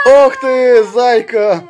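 high, loud cooing at a dog; high-pitched, doting exclamation; delighted pet praise. Real, not acted. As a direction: gush loudly over a beloved pet. Fussing over the dog.

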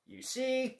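high, lightly voiced frog. Frog explains smiling to Bear, high voice. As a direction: pipe up like a wise little frog. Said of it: "said the frog".

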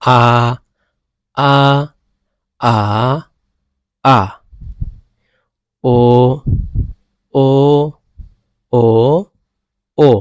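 আ and ও sung through the four tones; teacher's tone drill; tone practice. Shown as a demonstration. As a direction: drill আ then ও across the tones. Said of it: "এখানে দেখেন".

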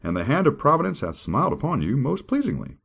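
grand, high dramatic reading; scripture reading. Acted. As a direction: declaim it like a preacher. Reads scripture.

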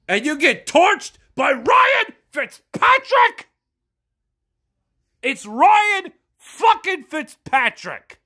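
furious fan shouting at his defense; angry shout. Real, not acted. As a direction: yell it in outraged disbelief.

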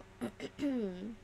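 clearing the throat before singing; short rough ahem. Real, not acted. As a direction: clear your throat, worried about cracking. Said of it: "I think my voice will crack".

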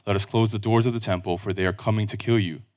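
ordinary delivery

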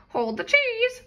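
high parrot squawk. Acted. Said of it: "squawked the parrot".

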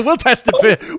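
a giggle through the line; laughing cop voice. Pretend. Listener joking as the Welsh cop, laughing. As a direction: laugh while playing the cop.

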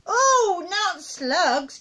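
put-on high, loud child's dismay; high-pitched boyish protest; horrified cry. A narrator's reading. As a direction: cry out like an appalled boy. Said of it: "George cried out".

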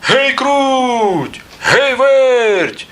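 high, drawn-out call with falling tail; rooster calling out. A narrator's reading. Rooster summons the two mice, calling out. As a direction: holler the names long and high. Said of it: "Та й знов гукає".

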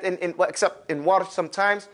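ordinary talk, nothing marked

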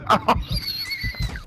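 high shrieking burst of laughter. Real, not acted. Reacting to being beaten.

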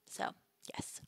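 a breathy whispered "so, yes"; whispered aside. Real, not acted. Streamer tacking a sly whispered aside onto a joke. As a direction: drop to a secret whisper.

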